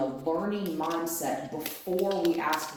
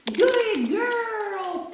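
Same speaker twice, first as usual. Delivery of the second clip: high dog praise; 14 semitones higher, 7 dB louder, slower than usual. Real, not acted.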